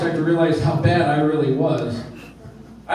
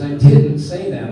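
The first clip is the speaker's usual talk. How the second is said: louder and slow, a firm denial; insisting; 8 dB louder, slower than usual. Retold or imitated. Wife flatly denies the husband's claim, louder and slow. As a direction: plant your feet and deny it.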